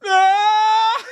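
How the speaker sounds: long, very high, loud wail; hammed-up bawling shriek; mock crying scream. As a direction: scream out like a spanked kid. Spanked boy finally cries out.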